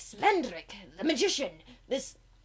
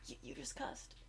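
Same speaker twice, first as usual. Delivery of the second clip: whisper; 6 semitones higher, 14 dB quieter than usual. Real, not acted. Shocked whisper.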